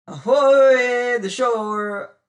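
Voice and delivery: loud hailing roar. Acted. Godby hailing the shore, roaring. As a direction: roar it across the water. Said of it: "roared Godby's great voice".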